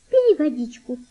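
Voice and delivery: high-pitched girl, lightly voiced; gently urging girl. A narrator's reading. Girl puts flask to the boy's lips, gently urging. Girl urges boy to drink.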